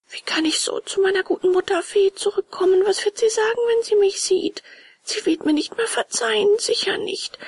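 high puppet voice, fretting; high, self-reproaching puppet; remorseful puppet. A narrator's reading. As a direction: worry aloud in a high-pitched voice.

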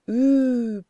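high, long-drawn word like a distant voice; whisper from afar. A narrator's reading. Fading angel answers from a great distance, stretched and ghostly. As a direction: draw out every syllable, as from far away. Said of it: "flüsterte es aus weiter Ferne".

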